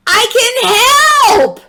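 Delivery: high, loud eager shout; a girl hollering that she can help. A narrator's reading. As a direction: yell it out to the whole class. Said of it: "Zoe hollered".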